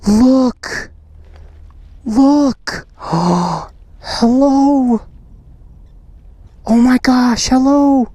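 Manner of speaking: high, gasping delight; delighted excitement. Real, not acted. Vlogger greeting a ladybug on the camera, delighted. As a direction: coo in thrilled wonder at a tiny visitor.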